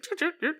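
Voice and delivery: high, soft little chirping noises; imitated mechanical chirring. Storyteller miming the hatchet being taken apart, imitated chirring. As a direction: make small, quick dismantling sounds.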